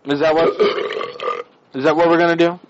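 ordinary delivery

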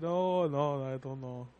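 quietly mimicked, dejected brush-off; sad imitation. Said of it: "He sounds sad".

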